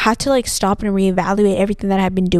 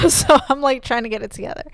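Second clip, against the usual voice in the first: holding back tears; 3 semitones higher than usual. Real, not acted. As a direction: fight back the tears while trying to keep talking. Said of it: "I'm getting a little bit emotional".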